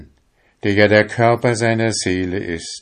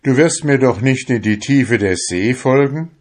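higher, louder shouted question; 6 semitones higher, 6 dB louder than usual. A narrator's reading. Fisherman shouts a doubting question at his soul. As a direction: fling the question out. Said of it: "schrie der junge Fischer".

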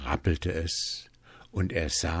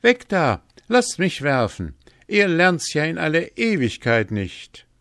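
higher, louder impatient call; 10 semitones higher, 6 dB louder than usual. A narrator's reading. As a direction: shove them aside with a loud, high voice. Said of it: "Hans sprang auf und rief".

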